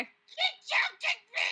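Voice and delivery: very high, slow, loud complaint; incredulous mock wail. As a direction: stretch it out high in disbelief. Outcry at refusal to help.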